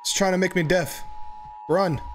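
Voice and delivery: frightened yelling while fleeing; panicked shouts, running for life; scared horror game streamer. Real, not acted. Chased by monster.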